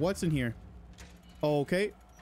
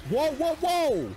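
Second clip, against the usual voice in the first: loud, high, drawn-out cry of alarm; alarmed shout; 13 semitones higher, 5 dB louder, slower than usual. Real, not acted. Player startled by the monster, alarmed shout. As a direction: yell it high and slow, startled.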